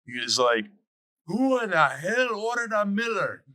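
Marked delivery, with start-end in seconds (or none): 1.3-3.4 high-pitched, indignant Irish bartender; thick Irish brogue. Retold or imitated. Bartender demanding who ordered the wrong beer, thick Irish brogue. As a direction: put on a broad Irish brogue, outraged. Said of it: "in his thick Irish brogue".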